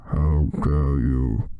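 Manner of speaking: low, loud, very slow menace; demanding. Acted. Snape confronting the students, low slow loud demand. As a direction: drag it out low and heavy. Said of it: "demanded Professor Snape".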